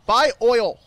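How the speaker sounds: loud, high demand; shouted order. Acted. Shopkeeper yelling at a customer to buy oil, loud. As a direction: yell it at the customer.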